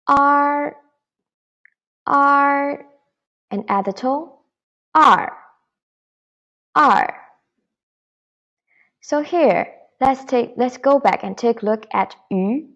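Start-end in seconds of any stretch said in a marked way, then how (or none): 0.1-7.2 er drawn out high, then er with its tone; pinyin er. Shown as a demonstration. Teacher leads students in repeating er, clearly modelled. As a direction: say er for the class to repeat. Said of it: "follow please".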